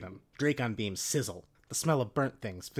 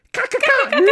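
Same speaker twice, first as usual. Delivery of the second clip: very loud, high squawking ka-ka-ka; bird cackle; 16 dB louder than usual. Acted. All of them cawing in triumph, loud birdlike cackle. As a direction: squawk it like a flock of birds. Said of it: "You all say".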